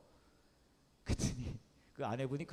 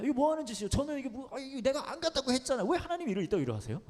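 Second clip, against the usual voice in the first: mock outraged prayer; 12 semitones higher than usual. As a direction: vent at God like a wronged child. Complaining to God while driving.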